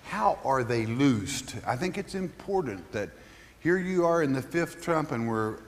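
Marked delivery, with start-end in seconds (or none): none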